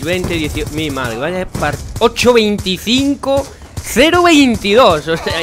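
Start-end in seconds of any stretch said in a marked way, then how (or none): 2.0-5.1 high, slow, incredulous read-out; loud, high-pitched dismay at the scores; appalled disbelief. Real, not acted. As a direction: drag out each score in raised disbelief. Appalled reading out match scores.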